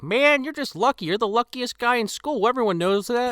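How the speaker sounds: high mock voice of a jealous friend; mocking Russell. Friend grumbling that Kyle is lucky, high mock voice. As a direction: pitch it up to mimic a whiny pal. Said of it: "Russell's like".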